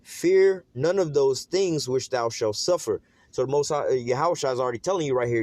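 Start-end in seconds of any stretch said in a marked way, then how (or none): 0.0-3.0 slow, deliberate scripture reading, every word spaced; read slower. Shown as a demonstration. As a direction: draw each word out, weighty and slow. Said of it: "Let me read that slower".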